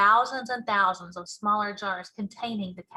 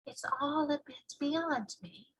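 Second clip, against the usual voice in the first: put-on girl voice; 5 semitones higher, 8 dB quieter than usual. Sophie puzzled.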